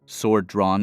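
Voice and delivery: ordinary delivery, nothing marked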